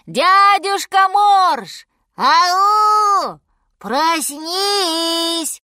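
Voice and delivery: very high, loud, drawn-out hollering; long stretched calls at full voice; shouting together. A narrator's reading. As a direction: yell to wake a deaf sleeper. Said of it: "И они закричали".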